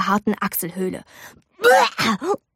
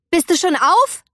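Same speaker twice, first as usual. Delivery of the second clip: calling out; 8 semitones higher than usual. A narrator's reading.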